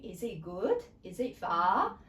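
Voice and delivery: "good" and "far" lifted at the end like yes-no questions; questioning rise. Shown as a demonstration. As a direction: ask it, swinging up on "good" and "far". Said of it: "you make a yes or no question".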